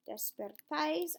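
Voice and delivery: Despertáis with the stress on the final A. Shown as a demonstration. Teacher showing where Despertáis takes its stress. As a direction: land hard on the A of Despertáis. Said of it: "accent on that A".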